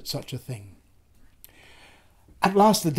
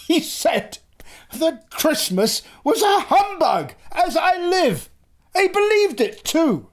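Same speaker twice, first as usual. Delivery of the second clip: laughing nephew; 13 semitones higher, 3 dB louder, slower than usual. A narrator's reading. Fred laughing about Scrooge.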